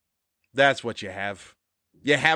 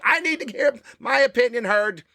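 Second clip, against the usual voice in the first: lightly mocking, higher self-important voice; mock social media poster; 11 semitones higher than usual. Host mocking social media posters, higher mock voice. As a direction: play the needy poster, pitched up. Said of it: "That's the whole thing about social media".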